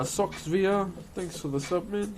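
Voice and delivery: ordinary talk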